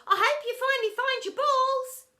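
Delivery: high, loud parting call. A narrator's reading. Pupil's parting jibe to her teacher, calling out. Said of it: "called out Rebel".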